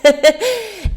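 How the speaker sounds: laugh. Real, not acted. Laughs after imitating Jackie.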